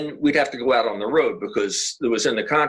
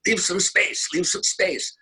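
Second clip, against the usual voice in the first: higher-pitched mock yell, no real volume; 7 semitones higher, slower than usual. Old-school jazz leader ordering his player, mild mock yell. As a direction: imitate an old-school bandleader's yelled order. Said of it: "turn around and yell at you".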